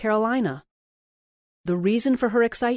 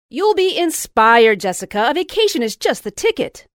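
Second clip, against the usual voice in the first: eager encouragement; 5 semitones higher, 8 dB louder than usual. A narrator's reading. Friend urging Jessica to take the vacation, loud and high. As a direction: sell the vacation hard.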